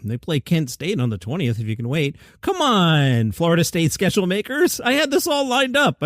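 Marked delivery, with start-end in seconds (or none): 2.4-6.0 higher, playful grumble at the schedule makers; mock complaint. Real, not acted. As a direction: whine that the plan fell apart.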